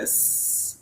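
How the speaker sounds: soft unvoiced hiss like a snake. Shown as a demonstration. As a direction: hiss out a long snake sound for a child. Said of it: "qual o som da cobra?".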